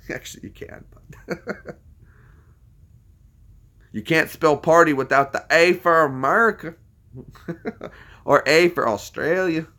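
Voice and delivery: usual speaking voice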